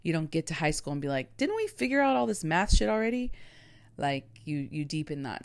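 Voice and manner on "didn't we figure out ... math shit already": exasperated complaint. Retold or imitated. Mock high schooler complaining.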